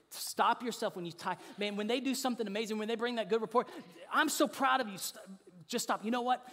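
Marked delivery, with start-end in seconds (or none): 4.1-5.0 louder, higher burst of praise; gushing parent. Acted. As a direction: mimic an excited proud parent. Mimics parent gushing at good report.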